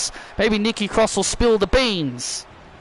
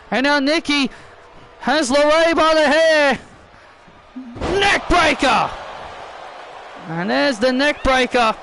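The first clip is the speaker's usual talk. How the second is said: excited play-by-play; 5 semitones higher than usual. Acted. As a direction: sell every move to the crowd. Live wrestling play-by-play.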